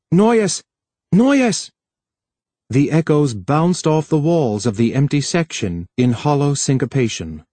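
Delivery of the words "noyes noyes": high, urgent calling out; raised voice. A narrator's reading. Harlan calls for Noyes in the empty section, raised voice. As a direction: call the name out, pitched right up. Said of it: "and raised his voice".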